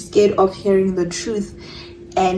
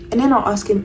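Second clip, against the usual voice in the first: plain reading voice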